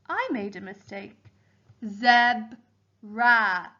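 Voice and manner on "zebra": "Zebra" as zeb, pause, ra; teacher sounding "Zebra" in two beats; split into syllables. Shown as a demonstration. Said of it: "The second syllable was ra".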